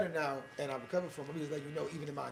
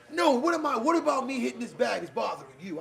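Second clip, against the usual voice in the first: loud, higher aggrieved challenge; angry retort; 5 semitones higher, 5 dB louder than usual. Retold or imitated. Gym guy confronting him, loud aggrieved challenge. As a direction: bark it back like the offended gym guy. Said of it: "he was like".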